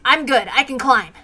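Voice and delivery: ordinary delivery, nothing marked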